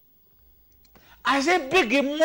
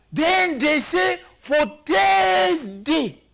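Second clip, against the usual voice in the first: slow loud announcement; 6 semitones higher, 4 dB louder, much slower than usual. Real, not acted. Announcing Thursday's rule.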